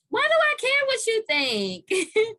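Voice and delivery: high, loud and slow, dismissive; loud, slow brush-off; defiant. Real, not acted. Defiant punchline about rumors.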